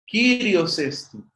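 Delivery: slow, stressed Kyrios Estin; reading model. Shown as a demonstration. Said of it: "Kyrios Estin sería la lectura". (lee "Kyrios Estin" despacio, acento en "Kyrios")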